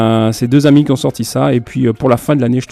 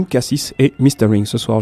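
ordinary talk, nothing marked

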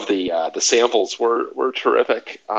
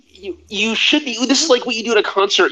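high, eager joking; excited; 9 semitones higher than usual. Real, not acted. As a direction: keep it bright and animated. Continuing lighter joke.

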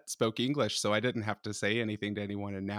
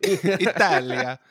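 drawn-out, louder hammed-up Italian Italia; mock Italian accent; 6 semitones higher, 7 dB louder, much slower than usual. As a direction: stretch it out in a showy Italian accent. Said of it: "Italian words with an Eng".

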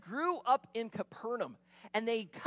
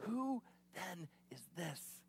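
slow, soft, low awed question; hushed, slow mock awe; 4 semitones lower, 10 dB quieter, slower than usual. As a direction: breathe it out in wonder. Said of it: "they utter themselves".

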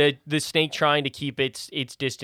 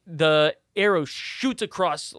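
ordinary talk, nothing marked

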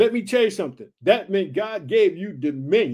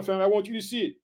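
usual speaking voice

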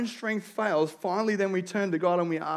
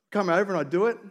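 usual speaking voice

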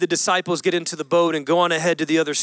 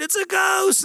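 a sharp, much higher cry of fright; cried out in fear; 12 semitones higher than usual. Acted. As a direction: cry it out like a terrified fisherman. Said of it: "they cried out".

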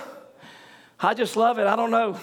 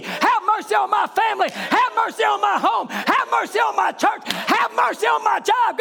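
pleading prayer cry; 9 semitones higher than usual. Acted. Preacher crying out for mercy before the church. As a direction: cry out to God for mercy at full force.

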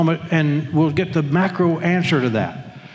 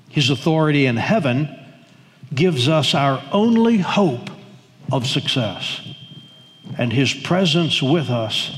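slow, lower reading with weighty pauses; measured quotation reading; 4 semitones lower than usual. Real, not acted. Preacher reading John Stott to the congregation, slow and low. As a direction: read it out phrase by phrase, sinking lower.

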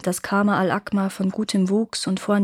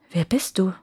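calm whispered question. A narrator's reading. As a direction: ask it hushed, just waking. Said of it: "fragte sie ruhig".

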